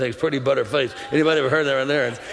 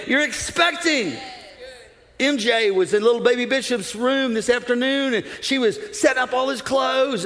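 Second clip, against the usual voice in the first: higher-pitched, rousing sermon delivery; fervent preaching; 8 semitones higher than usual. Acted. Preacher tells church about expecting a grandchild, fervent preaching. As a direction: preach it with excited conviction, pitch held high.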